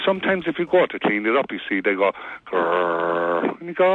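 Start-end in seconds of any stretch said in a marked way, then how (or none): 2.7-3.6 dog growl. Retold or imitated. Dog guards its toy pile.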